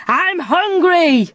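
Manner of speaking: slow, drawn-out hungry pirate bellow; angry shout. A narrator's reading. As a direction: grumble it out slowly like a hungry pirate. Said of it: "began to shout angrily".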